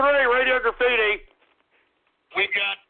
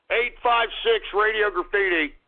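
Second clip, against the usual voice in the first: ordinary talk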